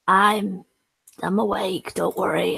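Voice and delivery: ordinary talk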